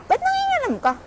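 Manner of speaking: high mock indignation; mock squeal.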